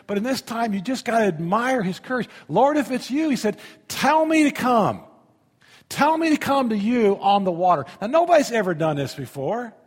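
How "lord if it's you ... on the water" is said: a hint of eager Peter shouting over wind; Peter calling out. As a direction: call across the water, bold.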